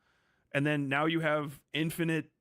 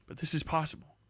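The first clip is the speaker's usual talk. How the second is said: ordinary talk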